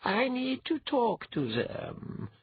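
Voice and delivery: high, slow, firm goddess voice; crisp, no-nonsense. A narrator's reading. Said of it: "crisp, no-nonsense quality".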